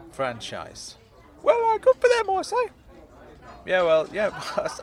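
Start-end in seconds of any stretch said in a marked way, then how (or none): 1.4-2.6 high, loud squeaky remark; high-pitched smug character voice; approving Claude. Acted. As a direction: declare it in a high piping voice.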